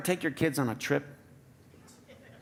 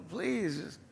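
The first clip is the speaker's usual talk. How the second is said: dismissive please; 3 semitones higher, 7 dB quieter than usual. Retold or imitated. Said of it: "I wanna laugh and go".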